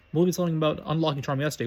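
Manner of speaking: ordinary delivery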